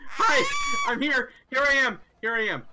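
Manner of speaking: very high, loud call. Real, not acted. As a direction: call out to be found. Calling out to teammate.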